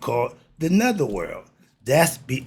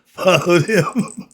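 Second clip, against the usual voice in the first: louder, slow, laughing it out; 6 semitones higher, 8 dB louder, much slower than usual. Real, not acted. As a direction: laugh through the words. Laughing after flubbing the line.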